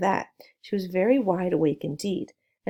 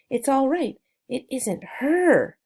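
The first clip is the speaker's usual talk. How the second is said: shouting; 6 semitones higher, slower than usual. Acted. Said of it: "he was shouting".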